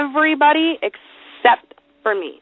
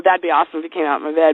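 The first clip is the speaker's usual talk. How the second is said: ordinary talk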